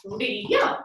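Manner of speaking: "Liam" split as LEE, YUM, rising on the second; one beat per syllable. Shown as a demonstration. Teacher leading two knee ups, "Liam" split into two beats. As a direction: stretch LEE, then pop YUM in "Liam". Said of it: "Let's do that again".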